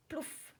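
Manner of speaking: high, soft plouf; playful splash sound. A narrator's reading. Splash of frogs diving.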